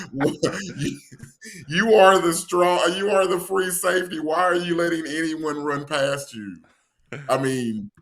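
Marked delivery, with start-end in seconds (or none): 0.0-6.6 Doctor Lee scolding. Retold or imitated. Quoting Drumline's Doctor Lee to mock the safety, hammed-up scolding. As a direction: lecture the player like a stern band director. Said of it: "what did Doctor Lee say".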